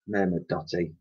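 plain reading voice

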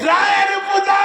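high, shouted outburst; angry threat. Acted. Goaded man answers what he'll do, shouting angrily. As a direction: yell it as a heated threat.

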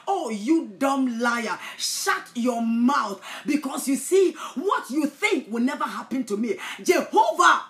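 loud, slow, rebuking shout; shouting down the false friend; furious shout. Acted. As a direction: rebuke the liar with full force.

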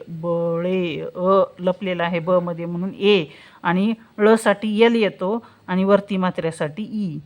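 ordinary talk, nothing marked

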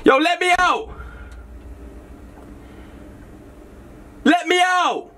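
high-pitched yell to be let out; desperate shouting. Acted. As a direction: shout through the van doors. Locked in van, calling out.

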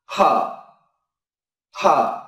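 "Thở" said with pressed lips and a strong burst of air; strong aspiration. Shown as a demonstration. Teacher showing lips pressed and a strong puff of air. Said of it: "mím môi và bật hơi thật mạnh ra".

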